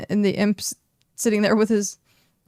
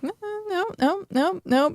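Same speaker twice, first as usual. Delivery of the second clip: imp denying; 10 semitones higher than usual. Acted. Imp denies blame.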